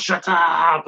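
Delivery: mock-yell it, stretching the words out; retold fed-up shout; frustrated outburst. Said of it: "when he does get frustrated up top and goes".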